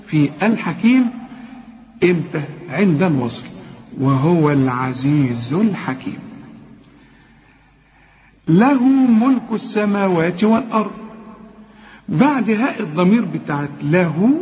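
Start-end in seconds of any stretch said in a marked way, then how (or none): 4.0-6.2 slow melodic recitation of وهو العزيز الحكيم; tajweed recitation; Quran recitation. Shown as a demonstration. Recites the verse. 8.5-10.9 له ملك السماوات والأرض recited melodically; tajweed recitation; Quran recitation. Shown as a demonstration. Recites the verse.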